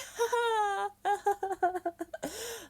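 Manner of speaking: high, drawn-out awkward giggle; mock nervous laughing; awkward put-on laugh. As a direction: laugh on and on to fill the moment. Acts awkward laugh beside idol.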